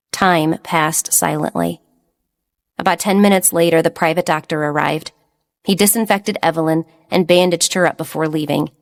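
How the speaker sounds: usual speaking voice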